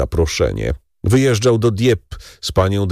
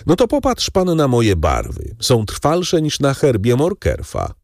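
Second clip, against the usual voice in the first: Danglars, boastful baron; 6 semitones higher than usual. Acted. Baron boasting to the count about his colours, higher voice. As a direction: puff up with pride over your own colours.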